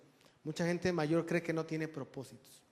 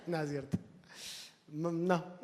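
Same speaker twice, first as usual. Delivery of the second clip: laughing; 5 dB quieter than usual. Real, not acted. Joking with congregation, laughing.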